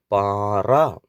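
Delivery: പാറ with a drawn-out aa; long vowel. Shown as a demonstration. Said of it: "ഒരുമിച്ച് വായിക്കാം". (പാറ എന്നതിലെ ആ നീട്ടി)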